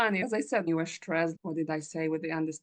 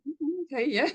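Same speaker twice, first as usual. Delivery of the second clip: amused guy; 8 semitones higher than usual. Retold or imitated. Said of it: "he smiled and be like".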